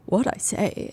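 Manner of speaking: soft mumbled repeat under her breath; a hushed echo of the lawyer's question; quiet muttered reaction. Retold or imitated. Recapping the trial, she softly echoes the lawyer's question. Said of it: "I remember being like".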